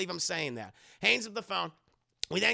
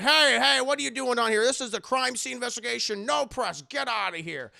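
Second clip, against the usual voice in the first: loud, raised-pitch order to intruders; stern cop shouting; 5 semitones higher, 6 dB louder than usual. Retold or imitated. Cops finding reporters at the crime scene, shouting them off. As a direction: call out sharply to clear them away.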